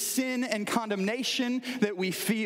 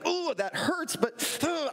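pitched-up pretend wince; mock brave sufferer; 9 semitones higher, slower than usual. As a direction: play it like shrugging off pain. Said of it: "it's like".

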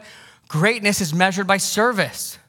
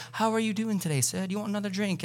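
mock British servant; 4 semitones higher, 7 dB quieter, faster than usual. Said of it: "didn't have British accents".

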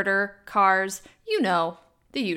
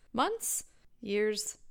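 rising doubt; 4 dB quieter than usual. Real, not acted. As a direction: ask it in sudden doubt. Self-doubting correction.

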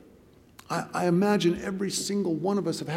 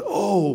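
higher, louder imitated crowd cry; 6 semitones higher, 5 dB louder than usual. Crowd watching the jumper crash, imitated loud "oh". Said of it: "There was a loud".

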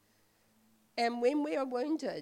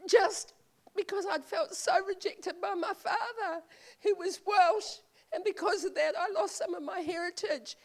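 tearful laugh; 7 semitones higher than usual. Real, not acted. Tells of rejection by Welsh father.

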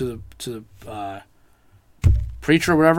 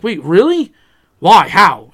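disbelief; 4 semitones higher, 10 dB louder, slower than usual. Retold or imitated. Shock at dog's death news.

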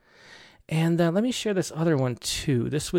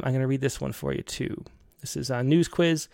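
ordinary talk

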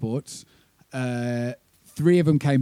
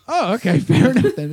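high, louder, laughing through it; laughing disbelief; 9 semitones higher, 7 dB louder than usual. Retold or imitated. Band waved through border, laughing in high disbelief. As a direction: giggle out the words in relieved surprise.

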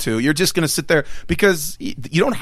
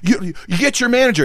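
mock outrage; 9 semitones higher than usual. Retold furious customer.

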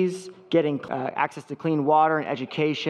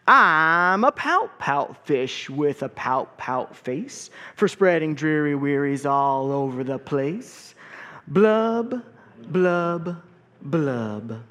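slow, mopey sing-song fish refrain; glum pout fish; much slower than usual. Acted. Gloomy fish chanting his refrain to his friends, slow and mopey. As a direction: drag out the gloomy fish's chant. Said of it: "there's a rhythm to this".

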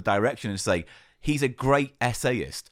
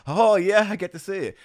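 excited; 9 semitones higher, 6 dB louder than usual. Retold or imitated. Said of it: "I'm like".

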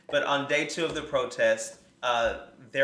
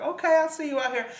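much higher, quick, playful cheer; mock cheer; 13 semitones higher, faster than usual. Host cheering a woman on her scooter, high and quick. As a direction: squeal it like cheering someone on. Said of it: "I was like".

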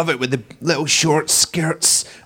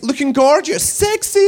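rising high gush; mock gushing comment; 8 semitones higher than usual. Female friends commenting on photos, rising high mock gush. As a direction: coo compliments like a friend online. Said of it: "it's like".

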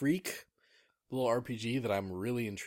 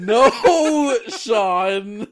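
high, loud laugh-shout; laughing protest; 12 semitones higher, 17 dB louder than usual. Real, not acted. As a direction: cry out through laughter.

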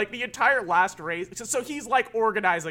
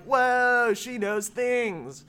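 drawn-out, higher mock "whoa"; mock amazement; 4 semitones higher, much slower than usual. Imitating guys stunned she knows cars, mock amazement. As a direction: play awestruck onlookers, stretching the words out. Voicing amazed onlookers in retold scene.

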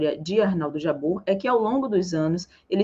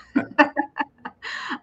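amused laugh at her own joke; playful self-mocking laugh; 7 semitones higher than usual. Real, not acted. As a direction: burst into a laugh. Laughs about her famous moment.

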